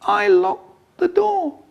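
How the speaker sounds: tiny, very high confession; slow, high, weedy child; weedy little voice. Acted. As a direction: squeak it out slowly and timidly. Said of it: "In a weedy little voice".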